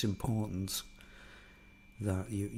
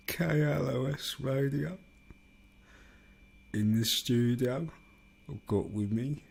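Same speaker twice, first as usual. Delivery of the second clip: radio DJ; 2 semitones higher, 3 dB louder than usual. Pretend. DJ introducing a guitarist in the studio, mock radio voice. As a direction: announce a studio guest like a radio host. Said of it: "radio D J sound".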